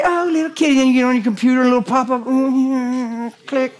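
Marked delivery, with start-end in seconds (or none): 0.0-0.7 high doting coo; cooing at kitty. Pretend. Owner doting on kitty, high coo. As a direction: coo at your pet.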